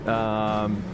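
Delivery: ordinary talk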